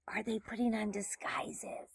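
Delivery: hushed, secretive whisper; whispered aside. Real, not acted. Reader asks children about disguises, whispered aside.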